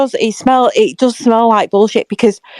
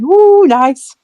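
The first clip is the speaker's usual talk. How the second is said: high, excited "ooh" imitation; giddy excitement; 8 semitones higher, 4 dB louder than usual. Fan showing host how they greet players, mock giddy excitement. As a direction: act out a giddy fan's delight. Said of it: "giddy and excited".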